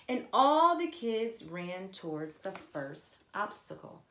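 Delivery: ordinary delivery, nothing marked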